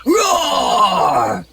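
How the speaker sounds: high shouted roar; ogre roar. Acted. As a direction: bellow to scare it off. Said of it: "he yelled at a cat".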